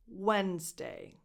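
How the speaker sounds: Wednesday said slowly as wens-day, no d. Shown as a demonstration. Teacher slowing Wednesday down for Italian learners. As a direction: stretch out wens, then day. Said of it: "You just say wens".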